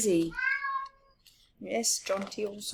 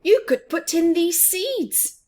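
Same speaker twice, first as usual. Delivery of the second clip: higher, louder mouse suggestion; Big Mouse voice; 11 semitones higher, 8 dB louder than usual. Acted. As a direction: coax him to plant seeds. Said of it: "said Big Mouse".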